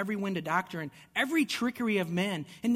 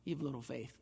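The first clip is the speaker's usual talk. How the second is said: gentle rebuke; 5 semitones lower, 10 dB quieter than usual. Acted. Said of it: "looks at the disciples and say".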